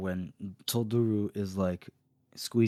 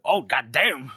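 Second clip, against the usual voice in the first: loud, higher-pitched awed outburst; hyped reaction to a jaw-dropping moment; impressed exclamation; 6 semitones higher, 7 dB louder, slower than usual. Retold or imitated. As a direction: blurt it out, raised and punchy. Voices own stunned reaction to scene.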